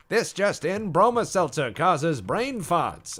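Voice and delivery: high, loud mid-Atlantic newsreader; old broadcaster's transatlantic accent. Shown as a demonstration. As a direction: announce a bulletin in a hammy mid-Atlantic accent. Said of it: "that transatlantic accent, mid-Atlantic accent".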